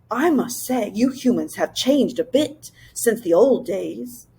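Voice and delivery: a friendly magical beast, voice set apart from the narration; cheerful creature voice. A narrator's reading. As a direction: greet the humans cheerily from hiding. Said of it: "a cheerful voice said".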